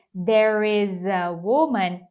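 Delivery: "there is a woman" linked, is running into a; "is a" said as one linked sound; linking demo. Shown as a demonstration. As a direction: blend "is" straight into "a" with no break. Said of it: "tem efeito cotonete aqui".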